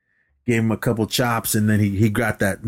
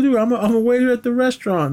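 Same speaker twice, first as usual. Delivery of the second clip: rushed and pitched far up; hammed-up waiter protesting fast in a squeaky voice; high fast protest; 13 semitones higher, faster than usual. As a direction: blurt it out high in mock indignation. Acted quote, protesting.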